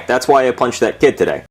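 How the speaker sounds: ordinary delivery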